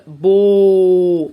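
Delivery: بو held long and loud; loud drawn-out sound. Shown as a demonstration. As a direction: belt out بو. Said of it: "sounding out board". (بو بصوت عالي ممدود)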